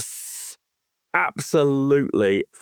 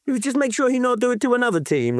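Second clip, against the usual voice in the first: mock Italian accent, higher and quick; 12 semitones higher, faster than usual. Podcaster quoting Italian players in a mock Italian accent. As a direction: play an Italian fan shrugging it off in an Italian accent. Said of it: "that Italian accent".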